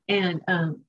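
ordinary talk

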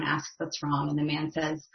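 normal talking voice

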